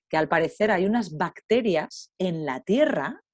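ordinary talk

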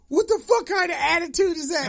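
outraged neighbor. Retold or imitated.

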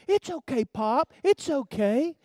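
lightly voiced child soothing grandpa; little granddaughter. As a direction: reassure him, lightly imitating a little girl. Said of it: "And she said".

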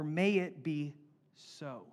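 slow, measured prayerful line; slow solemn. Shown as a demonstration. Pastor giving another gentle version, slow. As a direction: let each word settle.

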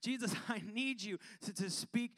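high, rising fervent plea; pleading prayer. Acted. Acted prayer pleading with Jesus.

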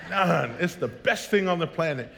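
ordinary talk, nothing marked

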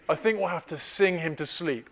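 ordinary talk

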